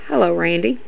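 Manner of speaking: low, soft deadpan greeting; straight-faced. Retold or imitated. Damien spots Randy behind Matt, straight-faced and hushed. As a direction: drop it quietly, as if the color just drained. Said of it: "goes straight face and goes".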